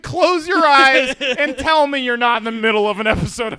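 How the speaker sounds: mock theatrical host; hammed-up announcer. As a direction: sell it like a carnival barker. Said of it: "Folks, Theater of the Mind".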